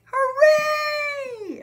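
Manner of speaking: high, loud drawn-out cheer; excited shout. A narrator's reading. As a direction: cheer it out with full force. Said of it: "everyone shouted".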